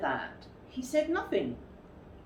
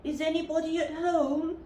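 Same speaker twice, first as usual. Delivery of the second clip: higher, called into the hole; calling out; 10 semitones higher than usual. A narrator's reading. Pooh calling into Rabbit's hole, called out. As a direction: call down into the burrow. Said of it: "called out".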